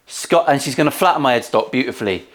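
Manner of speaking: normal talking voice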